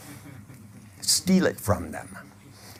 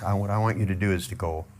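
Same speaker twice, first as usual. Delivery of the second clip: usual speaking voice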